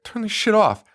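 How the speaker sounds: mock motherly scolding; retold angry order. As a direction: imitate an exasperated mother giving an order. Retold mother ordering her son.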